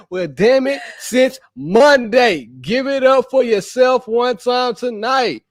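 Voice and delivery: ordinary talk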